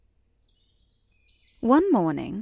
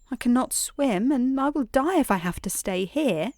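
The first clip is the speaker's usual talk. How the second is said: higher-pitched fretful complaint; acted tearful worry without sobbing; distressed wail; 5 semitones higher than usual. A narrator's reading. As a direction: plead in mild panic, pitch up. Stranded girl about to cry again.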